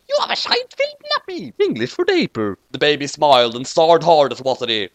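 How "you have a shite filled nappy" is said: very high British butler voice; British squeaky voice. A narrator's reading. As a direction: squeak it like a fussy English butler. Watari speaks to baby.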